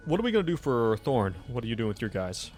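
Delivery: ordinary delivery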